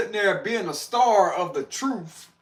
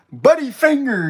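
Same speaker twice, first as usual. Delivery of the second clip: higher, louder playful call; 6 semitones higher, 6 dB louder than usual. Shown as a demonstration. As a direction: sing it out high and loud. Playing with the phrase.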